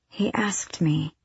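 soft whispered murmur. Acted. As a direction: breathe it out quietly. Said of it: "Aelin murmured".

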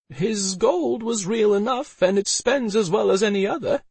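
high-pitched, unapologetic woman; defiant retort. A narrator's reading. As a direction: snap back defensively in a high voice. Elsa defiantly justifies the sale.

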